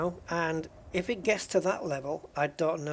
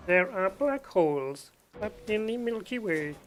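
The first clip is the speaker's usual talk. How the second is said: synthetic Hawking voice; 3 semitones higher than usual. Retold or imitated. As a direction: mimic the robotic speech-synthesizer voice. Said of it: "instead of going".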